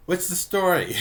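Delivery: high, loud querying; curious Jughead. Retold or imitated. Jughead asks what the game is, curious. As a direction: imitate a puzzled friend wanting answers.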